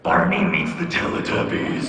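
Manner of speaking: slow, loud, super deep announcer; deep movie-trailer voice. Acted. Preview guy announcing any silly movie, deep booming voice. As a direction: boom it out like a film preview. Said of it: "always gonna use the deep voice".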